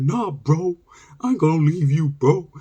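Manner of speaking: high-pitched, choked-up imitation; hammed-up emotional refusal to leave; mock teary-eyed pleading. Cousin to exhausted kid, mock tearful plea. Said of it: "we got teary-eyed 'cause he's like".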